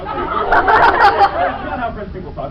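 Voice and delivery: slow and loud through laughter; laughing. Real, not acted. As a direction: laugh the words out. Laughing at fans' reaction.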